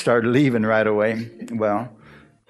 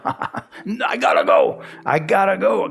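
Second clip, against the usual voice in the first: urgent escape; 8 semitones higher, slower than usual. Retold or imitated. I knew I was gonna bust out crying.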